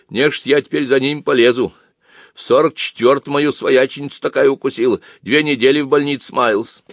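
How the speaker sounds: acted elderly village woman's lament; lamenting old woman. A narrator's reading. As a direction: complain to nobody in particular. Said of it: "Пожилая колхозница сетовала".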